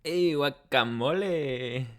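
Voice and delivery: slow, put-on Mexican voice; slow playful fake Mexican accent; mock Mexican accent. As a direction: stretch it out in a cheesy fake accent. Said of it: "I'm butchering it".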